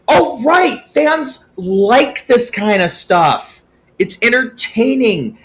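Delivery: high, loud, slow sarcastic voice; mock realization. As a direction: drag it out like a dawning idea. Voicing league's realization.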